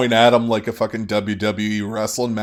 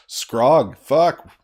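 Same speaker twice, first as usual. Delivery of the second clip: loud, higher frustrated curse; frustrated outburst; 5 semitones higher, 7 dB louder than usual. Real, not acted. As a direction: blurt it out in annoyance. Player swears at sudden mistake.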